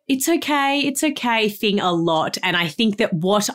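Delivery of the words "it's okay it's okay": soothing it's okay. Retold or imitated. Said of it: "I default to the".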